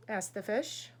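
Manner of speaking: plain reading voice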